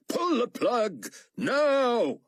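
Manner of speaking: frantic yell to cut it; desperate cry. A narrator's reading. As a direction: scream the order. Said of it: "he cried".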